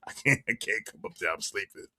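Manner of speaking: low, soft sleepy mumble; mock sleepy voice; mocking sleepy viewer. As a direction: play the drowsy excuse-maker. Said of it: "Tell about".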